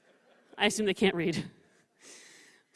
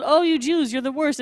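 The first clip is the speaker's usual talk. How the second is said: higher, louder exasperated scold; mock scolding prophet; 6 semitones higher, 8 dB louder than usual. Teacher recalling the prophet's rebuke, high and loud. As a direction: groan it at them like a prophet. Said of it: "So we were told".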